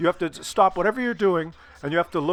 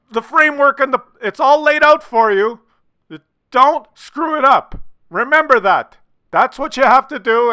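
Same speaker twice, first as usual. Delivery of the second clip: raised, high, insistent warning, pressing every word; stern warning; 8 semitones higher, 8 dB louder than usual. Real, not acted. Host warns viewers not to ruin the date, loud and stern. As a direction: drill the point home like a coach losing patience.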